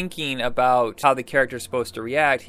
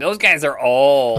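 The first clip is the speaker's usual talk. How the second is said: slow, louder put-on disdain; mock youthful dismissal; 6 dB louder, slower than usual. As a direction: drag it out like an unimpressed kid. Voices kids dismissing older stars.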